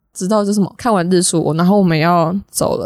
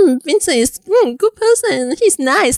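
slow, drawn-out, pitched-up mock praise; imitating classmates; 8 semitones higher, slower than usual. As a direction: mimic the classmates' sing-song compliment. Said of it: "身边的同学都说". (模仿同学慢慢拉长地夸人)

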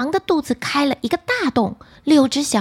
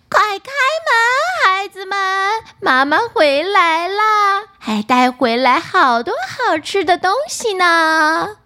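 wolf faking mother; 7 semitones higher, 4 dB louder than usual. Acted. Wolf tricks the kids again.

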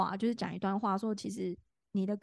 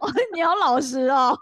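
louder, higher, said through laughter; laughing tease; 5 semitones higher, 10 dB louder than usual. Real, not acted. Teasing her friend through laughter about her honesty.

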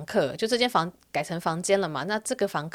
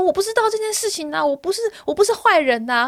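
higher whiny mock protest of a drunk man; drunk landlord; 11 semitones higher, 3 dB louder than usual. Drunk landlord protesting his innocence on the phone, mock whiny and higher. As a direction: play him pleading he's not a bad guy. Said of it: "然后他就说".

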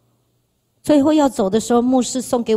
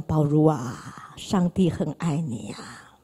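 slow, soft, whispered; lowered whispered comfort; tender whisper; 7 semitones lower, 8 dB quieter, slower than usual. Retold or imitated. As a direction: hush it tenderly like a blessing. Mary whispers tender blessing.

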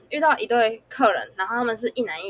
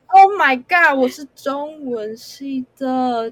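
loud, then drawn out slowly; indignant outburst; 3 semitones higher, 6 dB louder, much slower than usual. Retold or imitated. Server ranting inwardly at a rude customer, loud and then slow. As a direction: bristle at the insult.

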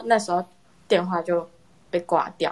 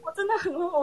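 flustered; 11 semitones higher than usual. Real, not acted.

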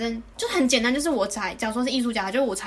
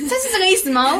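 higher, louder, startled question; startled disbelief; 9 semitones higher, 7 dB louder than usual. Retold or imitated. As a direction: ask it in shocked disbelief. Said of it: "有点吓到". (吓一跳地反问)

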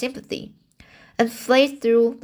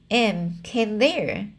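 ordinary delivery

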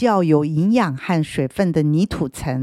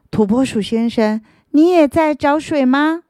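higher, louder eager question; eager root; 7 semitones higher, 4 dB louder than usual. Acted. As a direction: ask the groundhog brightly. Root asks the groundhog.

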